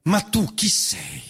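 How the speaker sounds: usual speaking voice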